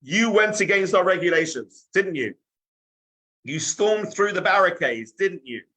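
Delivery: stern headmaster's telling-off; accusing headmaster. Acted. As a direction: confront the pupil with his offence. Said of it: "the boarding school's headmaster now wanted to have it out".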